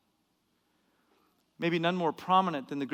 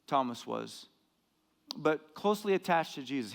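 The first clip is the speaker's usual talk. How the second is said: ordinary talk, nothing marked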